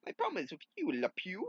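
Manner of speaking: a hint of a furious cartoon hero; hammed-up angry rival. Hero snapping at LePew, a touch of high-pitched anger. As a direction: voice jumps high and loud at LePew.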